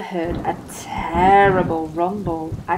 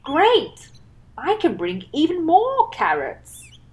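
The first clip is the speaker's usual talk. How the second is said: higher, eager and bright-voiced; thrilled to bring more; happy smile; 8 semitones higher than usual. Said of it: "Rabbit said with a smile".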